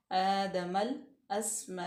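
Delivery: slow drawn-out recitation of आदमल अस्मा; Tajweed demonstration; Quranic recitation demo. Shown as a demonstration. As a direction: recite आदमल अस्मा slowly, no nasal sound on the meem. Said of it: "हम नाक में आवाज़ नहीं लेके जाएंगे".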